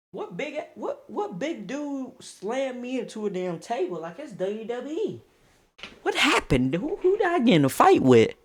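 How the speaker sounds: high-pitched, baffled questioning; bewildered. Retold or imitated. Teller coming to after being slammed, bewildered. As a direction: act out coming to and demanding who hit you.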